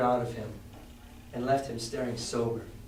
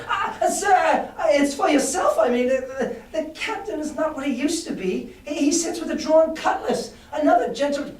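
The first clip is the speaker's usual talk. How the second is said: high, loud, desperate pleading; frightened cry; 12 semitones higher, 5 dB louder than usual. A narrator's reading. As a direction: cry out in fear. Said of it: "Then he cried".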